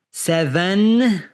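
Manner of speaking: SEVEN with a long, humming N at the end; drawn-out final N. Shown as a demonstration. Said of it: "has a N at the end".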